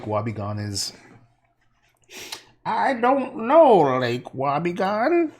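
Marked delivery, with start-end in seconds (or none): 2.6-5.3 high, slow drawling Hillary impression; exaggerated Hillary drawl; Hillary Clinton. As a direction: put on a mock Hillary Clinton voice. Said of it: "Oh, I sound like, um, Hillary Clinton".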